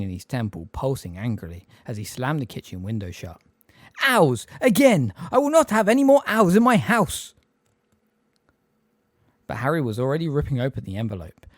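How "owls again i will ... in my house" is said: much louder, higher furious yell; furious roar. A narrator's reading. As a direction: forbid owls in his house. Vernon raging at owls.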